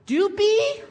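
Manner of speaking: loud, high questioning mock mom; baffled mishearing. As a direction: squawk back the misheard word as a question. Mishearing mom asks in retold scene.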